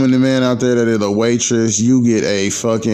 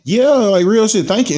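light up as it finally clicks; sudden realization; 11 semitones higher than usual. Retold or imitated. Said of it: "I was like".

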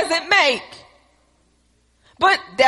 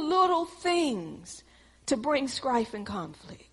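low, much softer sermon voice; hushed preaching; 3 semitones lower, 8 dB quieter than usual. Acted. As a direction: draw them in close and gentle. Quiet sermon passage.

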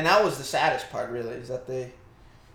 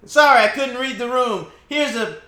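loud, higher apologetic cop; sheepish cop voice; 8 semitones higher, 8 dB louder than usual. Pretend. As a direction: apologize brightly as the clueless cop.

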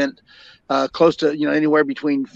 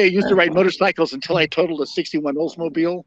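ordinary talk

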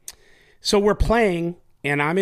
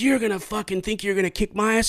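higher, hammed-up tough-guy challenge; mocking his challenge; 8 semitones higher than usual. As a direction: ape his cocky threat, pitched up. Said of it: "Like that".

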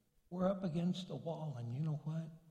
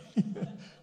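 breathy laugh; 4 dB louder than usual. Real, not acted. Laughing at own joke.

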